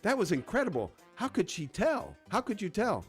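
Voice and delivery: high-pitched astonished woman; amazed, asking in wonder; stunned amazement. Acted. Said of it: "Stunned and amazed, the woman says".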